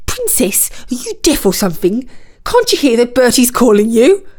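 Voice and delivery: brisk, nagging fish character; impatient carp. A narrator's reading. Carp nudging the princess, impatient.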